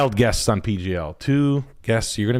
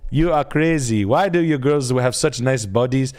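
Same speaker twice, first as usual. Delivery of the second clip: put-on lightly done Nigerian accent, drawn out and slow; a hint of a Nigerian priest marvelling at Australians; Nigerian teacher impression; slower than usual. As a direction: play his old Nigerian teacher, amused and exasperated. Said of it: "Mister Obadiah was his name".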